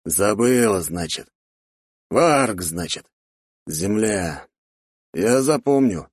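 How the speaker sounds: measured father's voice, word by word; note each fact gravely, with pauses; serious pondering. A narrator's reading. Father ends questioning his daughter, serious. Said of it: "серьёзно кивнул мужчина".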